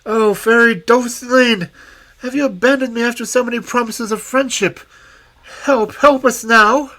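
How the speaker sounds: high, loud desperate plea; despairing cry. Acted. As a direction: beg the good fairy for rescue. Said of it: "In despair he cried".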